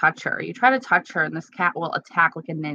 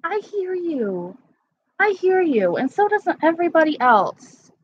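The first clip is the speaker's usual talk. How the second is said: talking to a pet; 10 semitones higher than usual. Real, not acted. Owner answers her yelling cat, high pet-talk voice.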